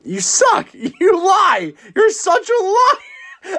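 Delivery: high, laughing shout, climbing as it goes; laughing accusation. Real, not acted. Host teasing co-host who dodged the draft question, laughing squeal. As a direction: playfully accuse him, breaking into high laughter.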